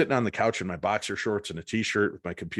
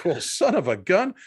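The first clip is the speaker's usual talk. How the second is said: high, louder amazed exclamation; mock astonishment; 9 semitones higher, 5 dB louder than usual. Man reacting to his ghost gadget, astonished.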